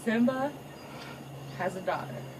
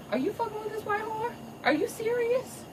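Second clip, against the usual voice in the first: high, disbelieving mom voice; mother appalled at her son; scolding mama; 10 semitones higher, 5 dB quieter than usual. Retold or imitated. Mother scolds son over his girlfriend, high and appalled.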